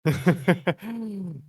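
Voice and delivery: bright burst of laughter; laughing. Real, not acted. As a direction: laugh out loud, delighted. Praised for his singing.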